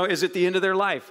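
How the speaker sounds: ordinary talk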